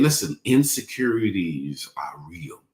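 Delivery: normal talking voice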